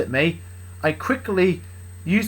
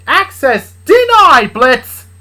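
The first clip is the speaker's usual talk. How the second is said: high, loud, slow denial; robotic refusal; 11 semitones higher, 14 dB louder, much slower than usual. Acted. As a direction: announce it slow like a gate.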